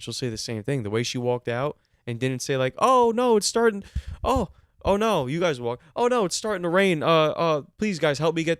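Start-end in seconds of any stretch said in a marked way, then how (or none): 2.8-8.6 high, louder mock fluster, easing down. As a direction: act a politely panicked plea to staff. Imagined flustered, polite manager.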